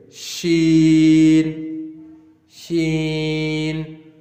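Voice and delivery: sheen with a long drawn-out sh. Shown as a demonstration. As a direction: stretch the sh of sheen. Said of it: "Same sound".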